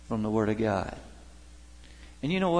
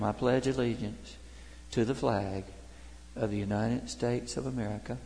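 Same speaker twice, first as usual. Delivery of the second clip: quiet, reverent pledge fading softer; soft recitation; 2 semitones lower, 7 dB quieter than usual. Acted.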